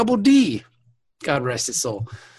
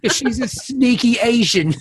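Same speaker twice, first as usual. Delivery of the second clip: higher, louder mocking Julian impression; 7 semitones higher, 4 dB louder than usual. A bratty Julian taunting O'Brien, higher and louder. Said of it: "I like your new Julian voice".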